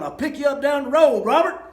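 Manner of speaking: hollering. Retold or imitated. Hollering to Robert while driving away, loud. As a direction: shout it back as you take off. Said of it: "I hollered at Robert".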